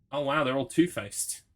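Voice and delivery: normal talking voice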